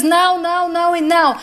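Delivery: mother's stubborn protest; emphatic refusal. A narrator's reading. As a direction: refuse over and over, heatedly. Mother refuses to let daughter go.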